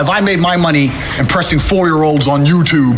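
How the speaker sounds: loud, firm callout delivered straight; formal callout speech. Acted. Fighter calling out Jake Paul, loud firm challenge.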